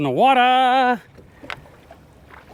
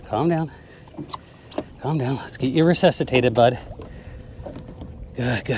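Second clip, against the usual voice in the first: soft, low soothing words; calm the fish down gently; 5 semitones lower, 7 dB quieter than usual. Real, not acted.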